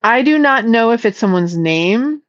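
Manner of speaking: ordinary talk, nothing marked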